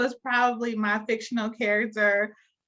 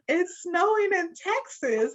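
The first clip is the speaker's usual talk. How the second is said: higher, drawn-out incredulous question; retold disbelief; 8 semitones higher, slower than usual. Guest recalling seeing snow in Texas, incredulous. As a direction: react in slow, high disbelief. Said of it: "and I was like".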